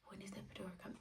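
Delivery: faint whispered question. Retold or imitated. Asking staff in pain, faint whisper. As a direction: ask it almost without voice.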